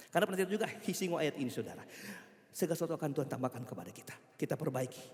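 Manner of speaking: ordinary delivery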